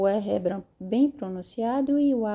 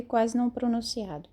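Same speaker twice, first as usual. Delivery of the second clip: normal talking voice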